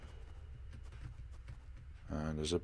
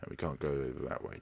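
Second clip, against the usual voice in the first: ordinary delivery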